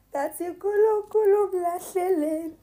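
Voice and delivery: high, loud yell; loud shout. Retold or imitated. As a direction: belt it out across the street. Shouting out in the street.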